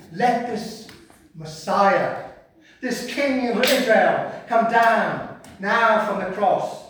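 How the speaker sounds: loud, high, drawn-out taunt; scornful religious leader's challenge; mocking. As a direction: jeer slowly at the so-called king. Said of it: "mocked him amongst themselves".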